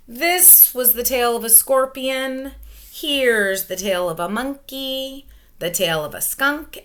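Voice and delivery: ordinary talk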